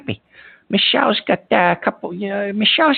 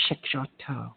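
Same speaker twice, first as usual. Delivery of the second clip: low muttered call-out; 11 semitones lower, 13 dB quieter, faster than usual. Acted. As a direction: drop it low and soft, as if to yourself. Host calling the next caller.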